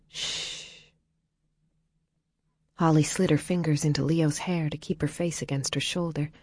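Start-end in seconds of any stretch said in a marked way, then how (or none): 0.1-0.6 soft whispered shh; soothing hush. A narrator's reading. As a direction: hush her gently. Soothing a crying friend.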